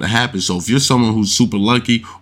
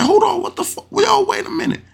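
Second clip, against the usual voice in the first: squeaky, far higher disbelief; imitating outraged player. Retelling the gambler catching the swapped ten, high outraged yelp. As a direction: yelp it like you just got robbed. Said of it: "He's like".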